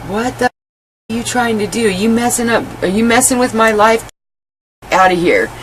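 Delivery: retold yelling. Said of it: "I yelled at him".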